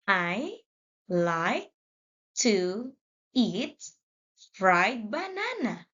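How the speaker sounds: each word read apart with pauses; slow word by word. Shown as a demonstration. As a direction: read it slowly, one word at a time. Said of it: "Let's read faster".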